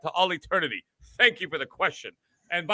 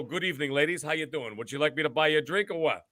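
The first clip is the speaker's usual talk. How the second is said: smooth pickup line; 4 semitones lower, 7 dB quieter than usual. Retold or imitated. Imagined bar scene, approaching two women, mock smooth and soft. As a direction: lower and much softer, a mock smooth talker. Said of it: "and I go".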